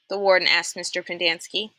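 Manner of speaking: usual speaking voice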